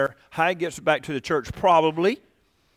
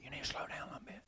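hushed whisper, no voice at all; whispered advice; 23 dB quieter, faster than usual. Retold or imitated. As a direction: lean in and whisper it like a private correction. Telling wife and daughter to slow down.

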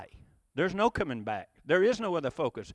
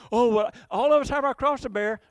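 higher, louder mock of a glib cliché; mocking quote; 5 semitones higher, 6 dB louder than usual. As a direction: ham up the pious cliché. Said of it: "How many times have you ever said that?".

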